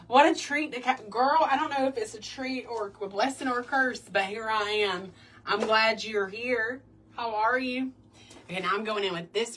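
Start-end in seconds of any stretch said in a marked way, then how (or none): 0.1-7.9 high, loud, gushing welcome; excited greeting. Real, not acted. Host welcoming a viewer to the live, excited and loud.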